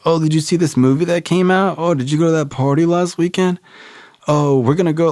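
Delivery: high, chirpy classmate voice; mimicking classmates' airy chatter, pitched high; mocking peers. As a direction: ham up the carefree popular kids.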